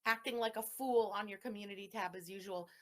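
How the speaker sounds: ordinary talk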